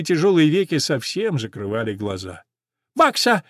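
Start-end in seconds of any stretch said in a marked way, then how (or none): 2.9-3.4 short high-pitched call; sparrow calling out. A narrator's reading. Sparrow calls old horse's attention, calling. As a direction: hail her in a raised bird voice. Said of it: "закричал воробей".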